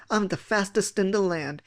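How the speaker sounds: plain reading voice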